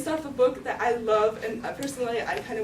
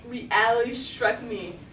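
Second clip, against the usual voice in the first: ordinary talk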